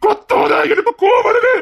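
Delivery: very high, loud raging yell; husband screaming at his wife to stop shouting; furious shout. Acted. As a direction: explode at her.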